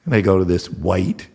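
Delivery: ordinary talk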